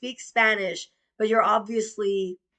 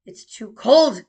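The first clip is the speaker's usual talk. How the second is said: exasperated complaint; 2 semitones higher, 11 dB louder than usual. Retold or imitated. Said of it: "And I'm all".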